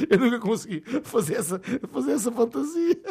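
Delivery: high voice cracking with laughter; laughing through the line. Acted. Father to son, played while laughing high. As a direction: try to stay in the role but keep laughing.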